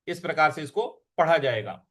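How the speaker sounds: normal talking voice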